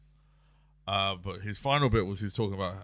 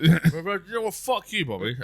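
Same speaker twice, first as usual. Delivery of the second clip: mock outrage; 8 semitones higher, 6 dB louder than usual. Mock outburst at absent comedian Bobby.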